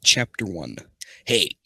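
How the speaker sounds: ordinary delivery, nothing marked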